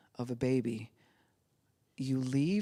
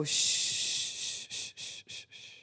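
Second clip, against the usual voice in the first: soft repeated unvoiced shh; rhythmic shushing; 6 dB quieter than usual. Shown as a demonstration. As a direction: shush a baby gently. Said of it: "why is it we go".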